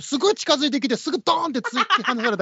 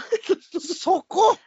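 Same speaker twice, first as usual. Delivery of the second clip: laughing disbelief; 10 semitones higher, much slower than usual. Real, not acted. Host laughs at odd compliment.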